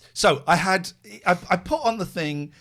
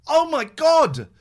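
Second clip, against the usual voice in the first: exasperated oh my God; 7 semitones higher, 9 dB louder, slower than usual. Real, not acted. Remembering the marketplace saga.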